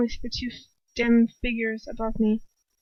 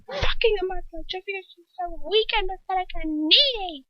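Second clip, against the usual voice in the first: much higher scornful tirade; raging Bellatrix; 9 semitones higher than usual. A narrator's reading. Bellatrix scorning Lucius for his lover. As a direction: pour out scorn.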